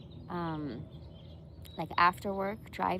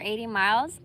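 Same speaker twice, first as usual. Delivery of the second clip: ordinary delivery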